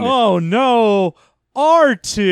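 hammed-up sitcom catchphrase, rising high; big drawn-out comic lament; mock sitcom dismay. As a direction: scold the droid for a studio audience. Sitcom gag before laugh track.

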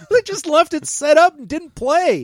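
high-pitched mock delight; excited soldier. Acting soldiers delighted by the game.

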